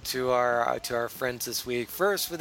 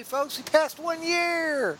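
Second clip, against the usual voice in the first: high, slow and stretched celebration; excited announcement; 13 semitones higher, much slower than usual. Real, not acted. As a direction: proclaim the milestone with glee. Celebrating the show's anniversary.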